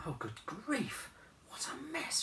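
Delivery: put-on high, slow, soft young woman; a hint of an exasperated girl; Anna's voice. A narrator's reading. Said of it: "she said".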